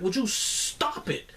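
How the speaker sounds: high, drawn-out plea without raising the volume; exasperated plea. Acted. Fan begging his team to stop hurting itself, exasperated plea. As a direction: plead slowly, stretching the words.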